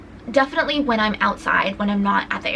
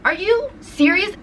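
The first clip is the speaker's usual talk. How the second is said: incredulous; 9 semitones higher than usual. Retold or imitated. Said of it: "And I'm like".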